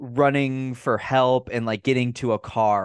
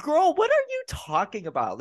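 bewildered and fed up, pitched up; exasperated disbelief. Real, not acted. Exasperated rant about the villain.

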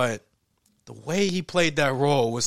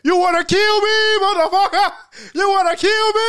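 much higher, loud crazed yell; wild challenge; 8 dB louder than usual. Acted. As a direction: taunt the killer, daring him. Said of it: "He's like".

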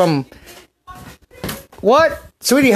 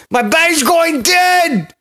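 alarmed shout; 10 semitones higher than usual. Real, not acted. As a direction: blurt out the panic at the top of your voice. Phone dying mid-recording.

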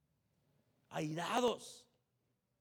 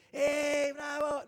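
mock cheering; 5 semitones higher, 6 dB louder, much slower than usual. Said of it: "no de las porras".

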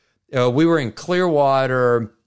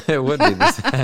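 talking through a laugh; amused, words breaking into laughter; 3 semitones higher, 4 dB louder than usual. Real, not acted. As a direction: laugh while agreeing. Laughs at the co-host's joke.

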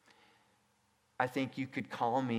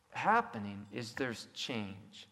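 ordinary talk, nothing marked

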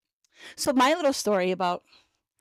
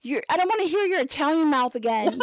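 put-on high, pleading boyfriend telling her to calm down; exasperated boyfriend; 6 semitones higher than usual. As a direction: plead with her not to start yelling. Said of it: "So he's like".

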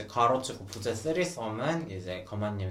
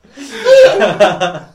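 loud high laughter; 9 semitones higher, 17 dB louder than usual. Real, not acted. Joke about the elevator.

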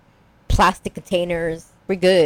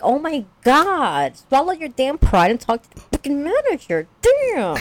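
mock exasperated scream; 7 semitones higher than usual. Host screams in her head at the proud husband, exasperated. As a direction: wail it at a stubborn husband in exaggerated frustration. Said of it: "I'm also screaming".

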